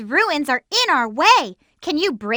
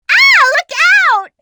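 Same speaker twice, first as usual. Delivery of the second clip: high, loud sudden warning; warning shout; 12 semitones higher, 8 dB louder than usual. Acted. Warning teammates of sudden danger, high loud shout.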